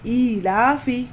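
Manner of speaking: ईलाफी slowly with the ई held long; stretched vowel. Shown as a demonstration. Teacher showing students the long ई in ईलाफी. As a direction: draw out the ई in ईलाफी. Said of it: "दो हरकत जितना खींच कर पढ़ना है".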